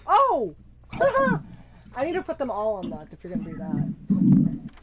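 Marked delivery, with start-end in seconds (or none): 0.1-1.4 startled oh, then a burst of laughter; surprised laugh. Real, not acted. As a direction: caught off guard, laugh it out. Friend messing with his chests.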